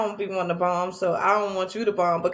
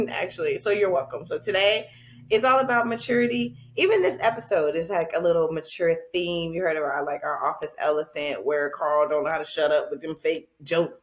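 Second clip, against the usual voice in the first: ordinary delivery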